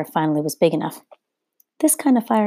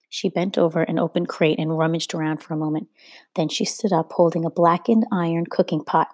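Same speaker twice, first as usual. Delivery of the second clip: ordinary delivery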